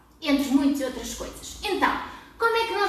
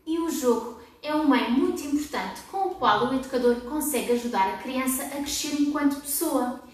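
ordinary talk, nothing marked

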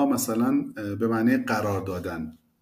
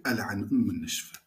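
muttering to himself; 2 semitones lower, 5 dB quieter than usual. Retold or imitated. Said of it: "با خودش میگه".

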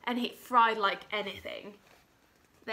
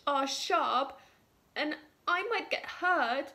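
high, trembling frightened cook voice; scared cook; 5 semitones higher than usual. Acted. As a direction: squeak it out like a terrified little cook. Cook fears the knives.

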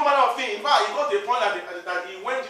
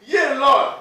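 louder cry of Yea, Lord; loud reply; 4 semitones higher, 9 dB louder than usual. Acted. Peter answering Jesus, a loud cry. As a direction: call out the answer.

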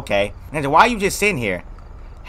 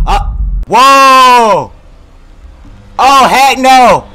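high, loud, drawn-out yells; alarmed yelling; 8 semitones higher, 8 dB louder, much slower than usual. Real, not acted. Player seeing something scary in the game, alarmed yell. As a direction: stretch each yell in alarm.

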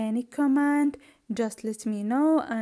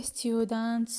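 normal talking voice

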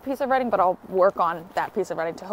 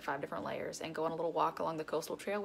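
usual speaking voice

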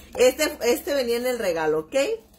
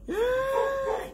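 thrilled cry; 3 dB quieter than usual. Real, not acted. Reacting to the swatch.

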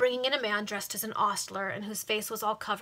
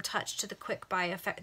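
plain reading voice

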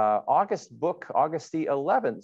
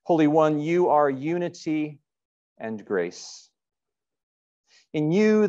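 louder, earnest pastoral prayer with long pauses; 3 dB louder than usual. Acted. Pastor leading the congregation in prayer, louder and earnest. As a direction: pray aloud to God for the whole congregation.

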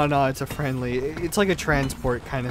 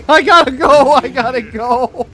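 laughing shout; 9 semitones higher, 11 dB louder than usual. Real, not acted. Apologizes while fleeing laughing.

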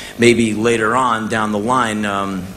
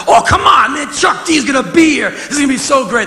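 put-on much higher, louder, shouting like a kid; giddy fan shout; 7 dB louder than usual. Telling bandmates [name] is coming, shouting giddily. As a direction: squeal with fan excitement. Excited fan before meeting idol.

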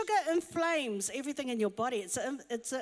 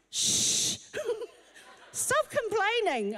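husband shushing; 9 semitones higher, slower than usual. Retold or imitated. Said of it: "my husband goes".

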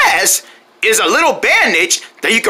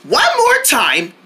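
ordinary delivery

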